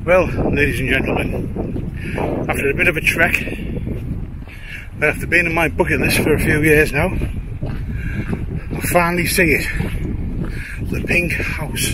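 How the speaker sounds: slow, grand mock announcement; announcer voice. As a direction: unveil it like a showman, slowly. Grand reveal of the goal.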